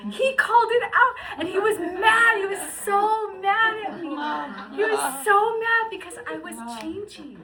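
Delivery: overwhelmed, laughing through tears; emotional, near tears. Real, not acted. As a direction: let the joy spill over into tears. Overwhelmed recounting falling in love.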